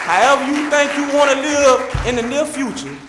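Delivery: loud rising shout to the crowd. Real, not acted. Speaker to the officers and crowd, shouting. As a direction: belt it out to rally them.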